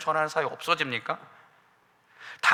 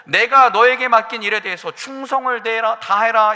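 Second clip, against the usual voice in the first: louder, firm divine command; commanding voice of God; 3 semitones higher, 7 dB louder than usual. Acted. God commanding Elijah to return to his work, loud and firm. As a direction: speak as God ordering the prophet back. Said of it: "하나님께서는 엘리야에게".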